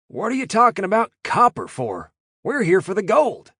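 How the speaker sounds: high, loud American voice; a scrawny Yank puzzled and complaining; confused Yank. A narrator's reading.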